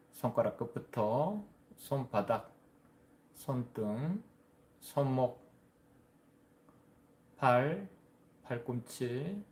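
measured body-scan guidance with long pauses; guided meditation. Real, not acted. (차분히 이끄는 명상 안내)

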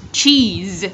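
"Cheese" drawn out to a buzzed Z; Z ending sound. Shown as a demonstration. Teacher modelling ending sounds, buzzed final Z. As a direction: hold the Z at the end of "Cheese". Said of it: "Z ending sound".

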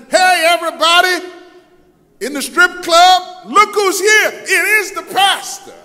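high, loud hollering to a crowd; mock public call-out. Patron exposing the pastor to the strip club, hollered call-out. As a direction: announce an acted scandal to the whole room.

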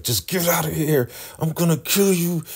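high-pitched mock fury; exasperated threat. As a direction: act out someone driven to the edge. Daikon fed up, threatens.

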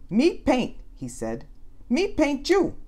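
put-on high little-kid voice; small child. A narrator's reading. Small Harry offers to paint Nate, high toddler voice. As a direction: talk like a toddler.

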